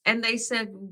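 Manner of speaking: ordinary talk, nothing marked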